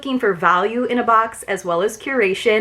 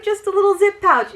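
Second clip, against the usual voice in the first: cooing over a cute pouch; 11 semitones higher than usual. Real, not acted.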